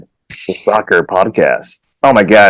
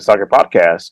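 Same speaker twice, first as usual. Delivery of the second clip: ordinary talk, nothing marked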